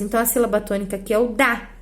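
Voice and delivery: normal talking voice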